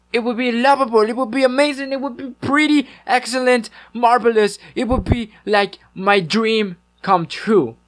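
high-pitched, hammed-up British accent; bad British accent. Streamer begging viewers for a like, high mock British accent. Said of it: "pretty bad British accent".

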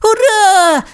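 very high little hurrah; excited cheer. Acted. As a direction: cheer like a delighted small dinosaur. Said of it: "закричав Тім".

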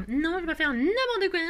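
high, distorted silly voice; playful mock protest. Crafter joking with viewers about her bee, silly mock protest. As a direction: clown the words, pitched well up. Clowns the line, then laughs.

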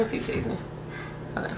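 whispered aside, half to herself. Real, not acted. Vlogger pausing to taste the honeydew, whispered. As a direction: drop to a breathy whisper as you taste. Tasting food aside on camera.

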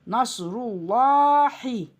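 "Nasrullahi" chanted with a heavy, long "llaa"; thick lam recitation. Shown as a demonstration. Teacher demonstrating the rule, "Nasrullahi" with thick lam. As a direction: recite "Nasrullahi" slowly, lam full and dark. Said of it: "Bunlar üstün ötre kalın".

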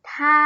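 ทา held long on an even tone; model pronunciation. Shown as a demonstration. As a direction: sound out ทา clearly for learners to copy. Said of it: "ออกเสียงว่า".

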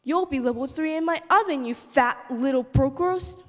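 loud growling wolf threat; yelling wolf. Acted. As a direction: roar it at the pig. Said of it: "and yelled".

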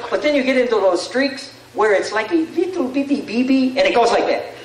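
high-pitched mock accent of a ballplayer; hammed-up imitation of a slugger's accent, pitched well up; attempted Sammy Sosa accent. Retelling the slugger's hitting streak for laughs, attempted accent. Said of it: "I can't do the accent".

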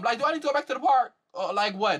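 shrill disbelieving rant, voice climbing; exasperated high rant. Real, not acted. Ranting at the cast for faking surprise, voice climbing high.

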